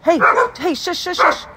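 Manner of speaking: high loud shush. Real, not acted. To the noisy birds outside, a high loud shush.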